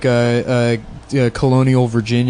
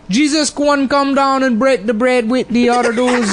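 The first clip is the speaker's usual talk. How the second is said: much higher, hammed-up Gullah storyteller voice; mock Gullah accent; 11 semitones higher than usual. Retelling the Gullah New Testament to friends, mock Gullah accent. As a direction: read scripture like a sing-song Gullah preacher. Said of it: "translated the New Testament into Gullah".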